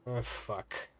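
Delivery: softly muttered curse; murmured under breath. A narrator's reading. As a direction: swear quietly to yourself. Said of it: "murmured under his breath".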